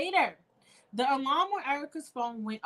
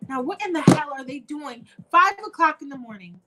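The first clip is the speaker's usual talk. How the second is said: annoyed outburst; 5 semitones higher, 6 dB louder than usual. Acted. Woken by construction noise, annoyed.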